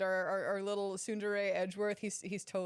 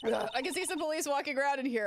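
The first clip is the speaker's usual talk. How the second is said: ordinary talk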